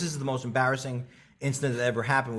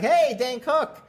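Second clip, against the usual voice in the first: mock greeting; 11 semitones higher, 3 dB louder, much slower than usual. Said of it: "so I was like".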